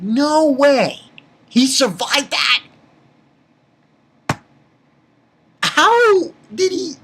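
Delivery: imitating his own amazement; amazed. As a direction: exclaim it high and stunned.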